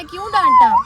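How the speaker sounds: very high, loud squeak; squeaky high voice. Acted. Relaying the question to the dog in a squeaky high voice.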